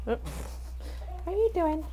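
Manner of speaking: squeaky surprised chicken voice; voicing the hens. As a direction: play a surprised chicken caught out. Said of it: "They're like".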